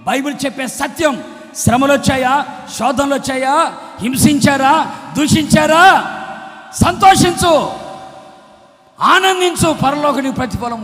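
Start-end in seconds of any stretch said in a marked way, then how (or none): 1.6-10.8 loud questions building to high, shouted commands; rousing preaching. Real, not acted. Preacher urging the suffering to rejoice, rousing preaching shout. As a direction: fire up the congregation.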